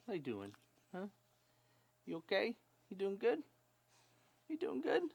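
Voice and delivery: high cooing questions. Real, not acted. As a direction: ask the puppy sweetly. Baby talk to puppy.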